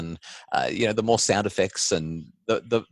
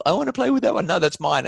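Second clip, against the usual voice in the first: put-on high, loud squabbling child; whiny kid voice; 12 semitones higher, 5 dB louder than usual. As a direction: whine like a kid grabbing a toy. Kids fighting over toys.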